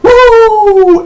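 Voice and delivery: a high, loud Ric Flair woo; high woo yell. Retold or imitated. Imitating Charlotte's woo cry to the crowd, loud and high. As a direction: belt out a shrill woo. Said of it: "And then the crowd goes".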